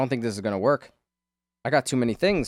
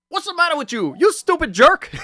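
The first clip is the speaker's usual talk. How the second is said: high, loud, put-on thug yell; mimicking the thug; 8 dB louder than usual. Quoting the movie thug insulting Jackie Chan, high loud yell. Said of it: "And the guy goes".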